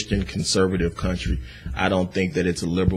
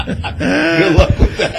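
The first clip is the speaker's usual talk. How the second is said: laughing; 10 dB louder, much slower than usual. Real, not acted. Host mocks donor wanting money back.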